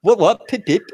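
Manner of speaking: high-pitched mock British toff. American teasing a Brit, mock British accent. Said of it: "we don't talk like that".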